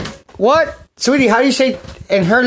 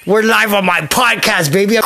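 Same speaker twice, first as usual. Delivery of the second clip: ordinary delivery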